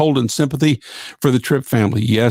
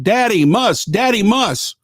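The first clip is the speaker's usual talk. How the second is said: mock toddler shouting; 8 semitones higher, slower than usual. Host reading out a listener's toddler story, mock toddler shouting. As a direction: imitate a toddler's excited call, pitched high. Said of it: "started shouting".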